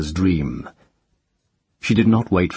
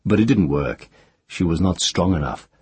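ordinary delivery

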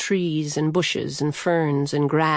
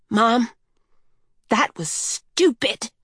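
higher-voiced cross child; furious child; 4 semitones higher, slower than usual. A narrator's reading. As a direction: scold your mom, restrained but cross. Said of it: "the child said furiously".

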